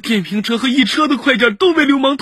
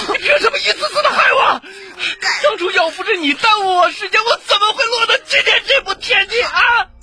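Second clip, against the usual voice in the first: high-pitched, anguished shouting; hysterical, accusing rage; enraged outburst; 8 semitones higher than usual. Acted. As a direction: pour out all the blame in a frenzy. Man rages while attacking her.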